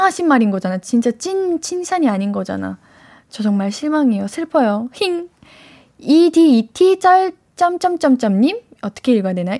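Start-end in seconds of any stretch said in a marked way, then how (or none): 4.9-5.3 soft pouting whine; a tiny sulky whimper; cutesy whimper. Acted. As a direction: aegyo pout sound. Host pouts after complaining.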